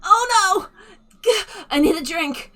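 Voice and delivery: very high, loud, frantic gnome squeal; high, loud panic of a frazzled gnome; dramatic flustered gnome. Acted. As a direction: fling it out like a melodramatic damsel.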